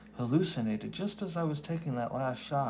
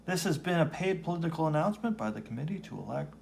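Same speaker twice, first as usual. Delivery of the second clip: fast, higher announcer rattle; disclaimer read; 6 semitones higher, faster than usual. Acted. Closing the campaign ad, fast announcer disclaimer. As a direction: rush the legal fine print.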